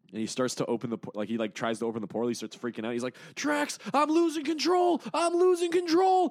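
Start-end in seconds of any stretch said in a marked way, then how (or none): 3.3-6.3 very high, louder, slow stretched wail; mock panicked cry. Richard freaking out to Drax, high slow panicked wail. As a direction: wail it like control is slipping away.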